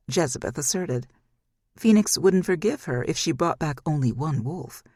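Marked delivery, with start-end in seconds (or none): none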